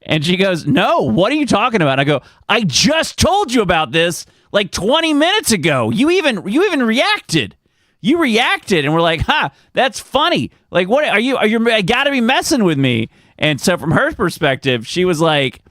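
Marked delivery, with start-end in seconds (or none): 0.7-2.0 higher-pitched baffled protest; retold confusion. Wife answers husband's accusation, confused. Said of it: "a moment of, like, confusion". 2.5-13.1 high-pitched, exasperated protest; retold frustration. Husband reminds wife he just told her, frustrated. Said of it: "and then frustration and then humor".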